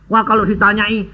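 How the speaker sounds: usual speaking voice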